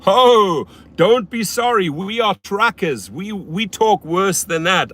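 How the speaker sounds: loud, high, drawn-out trucker reply; hearty mock trucker, booming the first word; big trucker. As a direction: play a big trucker waving off an apology. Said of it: "And he goes".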